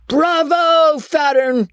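high mock cheer from the crowd; cheering wag. A narrator's reading. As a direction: call it out like a jeering wag. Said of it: "said one wag".

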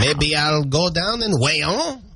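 slow mock French-Canadian accent; hammed-up Québécois English, words stretched. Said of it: "If I speak like I'm from Canada".

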